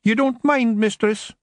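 high, shuffling Scots pixie voice; sheepish Rob. Acted. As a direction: wee Scotsman asking leave.

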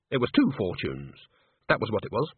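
usual speaking voice

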